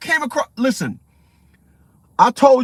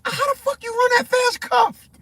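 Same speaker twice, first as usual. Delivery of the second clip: high, squeaky mock disbelief; amazed disbelief; 13 semitones higher than usual. Streamer retells his reaction to cuffed woman running, high mock amazement. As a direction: pitch up in hammed-up amazement. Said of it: "I'm like".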